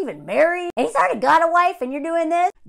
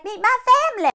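mock cheerful host; 10 semitones higher, 6 dB louder than usual. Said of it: "he's like".

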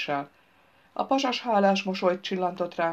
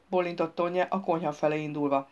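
usual speaking voice

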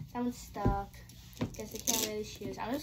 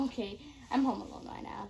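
usual speaking voice

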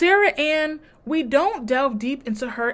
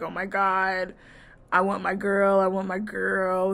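ordinary talk

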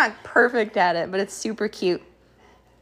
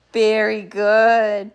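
ordinary talk

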